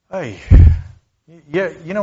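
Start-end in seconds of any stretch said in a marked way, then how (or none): 0.1-0.4 long audible exhale of exasperation; deep sigh. Shown as a demonstration. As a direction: sigh deeply, letting the breath out. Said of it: "He sighed deeply when they asked".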